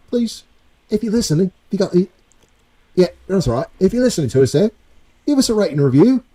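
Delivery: high mock British plea; British accent asking for ratings; put-on British accent. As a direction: keep the hammed-up British accent going. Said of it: "Let me know if this accent is shite".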